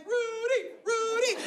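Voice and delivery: high chant of the name, dog's voice; his corgi cheering in a high voice. Retold or imitated. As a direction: cheer him on as a little dog. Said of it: "His corgi's over there being like".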